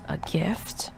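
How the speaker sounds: hushed eerie whisper. A narrator's reading. Narrator whispering the creepy question to listeners. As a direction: breathe it out like a secret.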